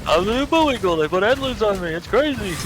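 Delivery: hammed-up cartoon bear; Yogi Bear-ish voice. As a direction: boast goofily as the new moose. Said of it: "sounds like fucking Yogi Bear".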